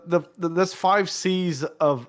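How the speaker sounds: ordinary talk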